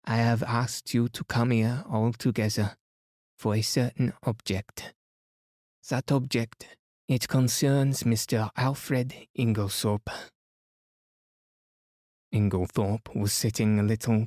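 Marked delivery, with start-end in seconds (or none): 0.0-10.1 put-on French-accented detective, formal; Poirot. Poirot announcing his object to the gathered household, formally accented. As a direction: address the room with a French accent.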